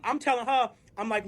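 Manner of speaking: ordinary talk, nothing marked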